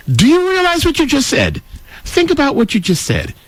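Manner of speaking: exasperated challenge. Real, not acted.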